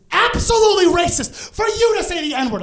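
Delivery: high, loud reprimand; scolding shout. Retold or imitated. HR manager scolding the comic at the meeting, loud reprimand. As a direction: scold him in front of everyone. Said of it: "starts screaming at me".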